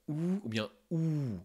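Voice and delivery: slow "OU ou bien OU", lips rounded two different ways. Shown as a demonstration. Teacher shows two lip shapes for OU. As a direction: stretch "OU" out, once each way. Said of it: "on pourrait le faire de deux manières".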